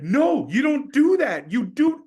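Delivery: high, loud, slow mock pastor voice; pastor correcting. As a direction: spell it out slowly like a patient mentor. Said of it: "He's like".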